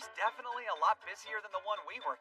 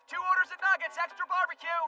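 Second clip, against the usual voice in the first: calling out orders; 8 semitones higher, 5 dB louder than usual. Acted. Rapid order calling at counter.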